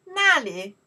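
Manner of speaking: 那里 with a strong clear li; stronger. Shown as a demonstration. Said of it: "more strong".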